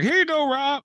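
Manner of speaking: high, sing-song imitating a helpful woman; mock helpful girl. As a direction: chirp it sweetly in a mock high voice. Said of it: "And Kat was like".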